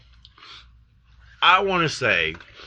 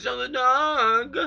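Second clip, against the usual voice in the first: slow, high mocking repeat; drawn-out high singsong echo; mocking quote voice; 13 semitones higher, much slower than usual. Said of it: "Everyone's saying".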